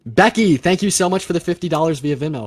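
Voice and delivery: loud, hyped thank-you; excited shout-out. Real, not acted. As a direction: shout thanks at full volume. Big donation shout-out.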